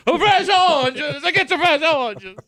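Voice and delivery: loud, slow Italian street vendor; Italian accent. Pretend. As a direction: hawk oranges in an Italian accent. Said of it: "Is that your Italian accent?".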